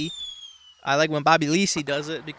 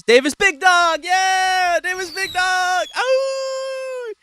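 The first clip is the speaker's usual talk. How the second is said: much higher, loud hype shouting of the name; 8 dB louder, much slower than usual. Real, not acted. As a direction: cheer him on like a fan in a stadium. Hyping up favorite reviewer.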